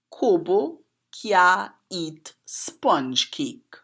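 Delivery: slow, careful proverb in Creole, every word spaced; slow Trini Creole recital. Shown as a demonstration. Poet teaching viewers a proverb, slow Creole delivery. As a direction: recite it slowly in Trini dialect. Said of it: "it goes like this".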